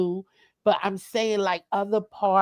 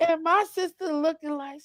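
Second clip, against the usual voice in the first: ordinary delivery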